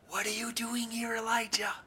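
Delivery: soft, breathy whisper up high; gentle whisper. A narrator's reading. The Lord speaking to Elijah at the cave in a soft whisper. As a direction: breathe the question like a still small voice. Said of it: "a sound of a gentle whisper".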